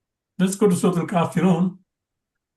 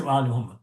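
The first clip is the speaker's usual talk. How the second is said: lower, falling close on "wa ala Muhammad"; resuming after the pause; 7 semitones lower, 3 dB quieter, much faster than usual. Shown as a demonstration. Teacher finishing the paused version, lower falling "Muhammad". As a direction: start afresh at "wa" and settle lower. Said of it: "then just pause there".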